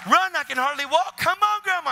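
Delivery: high, loud, breakneck excitement; acted excited urging. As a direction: act out an excited cry to get moving. Acted excited exchange while urging grandma.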